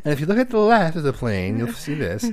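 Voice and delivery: lightly hammed cabin announcement; flight attendant voice. Shown as a demonstration. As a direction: point out the plane's left side. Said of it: "you sound like a flight attendant".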